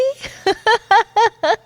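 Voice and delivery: high, loud peal of laughter; delighted laugh. Acted. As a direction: burst out laughing, amused. Delighted by coworker's nerves.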